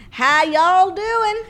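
high, loud, slow cowboy greeting; Prince Harry. Pretend. Royal greets everyone, loud, high and slow in a twang. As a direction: holler a slow howdy, way up high. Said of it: "It's me, Prince Harry".

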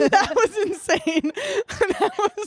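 high-pitched words through real laughter; laughing hard. Real, not acted. Friend reacting to comic's awkward opener, laughing hard. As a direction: barely get it out for laughing.

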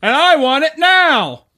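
high loud slow commercial shout; ad pitch voice. Retold or imitated. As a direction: belt it like a TV ad. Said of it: "it's my money".

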